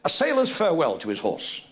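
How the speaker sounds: music-hall rhyming verse, declaimed for laughs; comic monologue recitation. Acted. Comedian recites a Billy Bennett monologue to the audience, comic verse recitation. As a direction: recite a mock-heroic sea poem to the audience.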